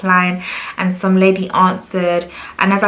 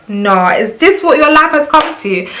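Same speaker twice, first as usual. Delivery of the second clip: indignant; 7 semitones higher, 4 dB louder than usual. Retold or imitated. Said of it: "I was just like".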